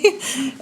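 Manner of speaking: a short choked laugh on the edge of tears; tearful laugh. Real, not acted. A mother telling of her daughter laughs through rising tears. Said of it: "ça va me faire pleurer".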